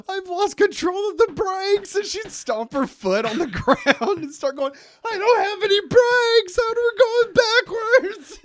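high, loud hammed-up alarm; mock panic. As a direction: fake a brake failure for the kids. Mom faking brake failure.

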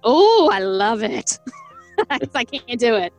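normal talking voice

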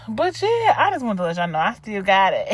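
normal talking voice

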